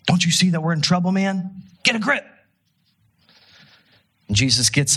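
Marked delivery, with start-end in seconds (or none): 0.1-2.3 high, fast, frightened accusing cry; panicked disciples. Retold or imitated. Disciples waking Jesus in the storm, panicked and high. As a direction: scramble the words out in panic, pitch climbing. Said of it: "fearful accusations".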